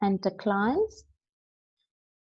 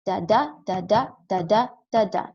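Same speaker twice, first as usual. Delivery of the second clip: "da, da" in pairs, second "da" punched louder; iambic stress; 3 dB louder than usual. Shown as a demonstration. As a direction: alternate unstressed and stressed "da", steady da-DA rhythm. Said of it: "five stressed syllables and un-, five stressed syllables".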